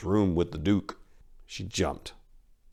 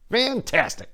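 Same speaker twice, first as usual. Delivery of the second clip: sarcastic. A narrator's reading. Narrator's sarcastic summary.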